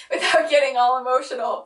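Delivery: real tears breaking into a laugh; laughing through tears. Real, not acted. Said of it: "I'm trying not to cry on camera".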